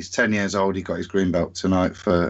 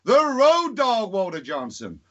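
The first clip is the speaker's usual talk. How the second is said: ring announcer; 12 semitones higher than usual. Acted. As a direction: belt it like a wrestling announcer. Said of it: "I'd do a ring call".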